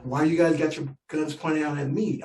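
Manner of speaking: usual speaking voice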